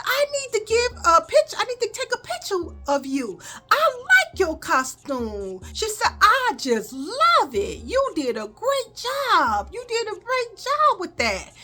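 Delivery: gushing lady. Retold or imitated. Lady at the door praising the costume, high and gushing. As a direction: gush over the child like a sweet lady.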